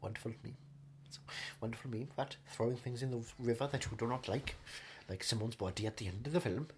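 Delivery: low, soft, rambling luvvie; wistful veteran actor. Acted. Actor reminiscing about his film co-stars, low and soft. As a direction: murmur fond praise like an old thespian.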